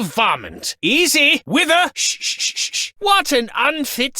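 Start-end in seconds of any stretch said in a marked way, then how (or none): 1.9-2.9 soft unvoiced shushing; calm the horse with shushes; hushing. Lord soothing his horse, whispered shushing.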